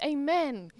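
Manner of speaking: ordinary talk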